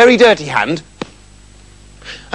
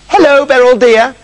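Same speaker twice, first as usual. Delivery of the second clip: lightly voiced king, higher and louder greeting; 7 semitones higher, 5 dB louder than usual. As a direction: greet the princess as her father the king. King greets his spoilt daughter.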